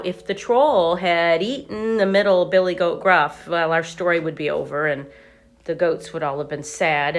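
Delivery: plain reading voice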